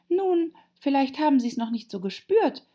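put-on high-pitched girl character; Alice, earnest and high; polite Alice. A narrator's reading.

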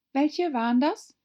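put-on high, loud girlish question; curious young Alice. Alice asks. (hohe, neugierige Alice-Stimme)

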